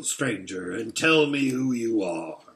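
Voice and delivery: low, menacing guard wolf; a wolf questioning an intruder; wolf's voice. Acted.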